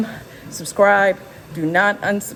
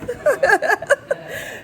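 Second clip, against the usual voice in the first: laugh. Real, not acted. Laughs at off-camera interruption.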